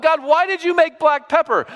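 high, whining mock protest; hammed-up high whine at God; whiny complaint to God. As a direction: whine at God like a wronged child.

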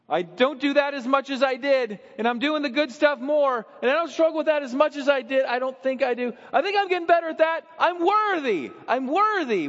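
high, loud, defensive insistence; mock a proud believer; self-justifying. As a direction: striver insisting he's worthy.

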